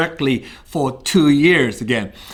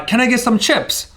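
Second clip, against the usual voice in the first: ordering at the pub again; 7 semitones higher than usual. Retold or imitated. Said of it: "went to a counter again and said".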